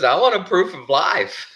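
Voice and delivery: high, loud, drawn-out demand; mock demand. Friend calls in after hours of silence, imitated loud demand. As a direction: insist on it, imitating the worried caller. Retells Alan's demanding phone call.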